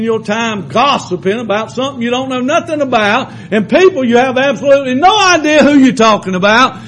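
a preacher hammering a point home to the church; preaching at full force. Acted. Preacher urging the church to stop wasting time, full-force preaching. Preacher exhorting the congregation loudly.

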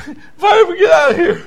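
loud, high revival preacher cry; impassioned shouted testimony; full-force preaching. Acted.

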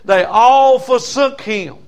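loud, high preaching at full volume; full-force preaching. Acted. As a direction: preach it hard to the congregation.

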